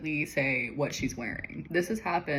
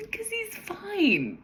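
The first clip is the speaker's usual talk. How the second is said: high, drawn-out, exaggerated insistence; sarcastically chirped denial; mock reassurance; 5 dB louder, much slower than usual. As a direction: stretch it out high and loud, clearly untrue. Mocking a character who isn't fine.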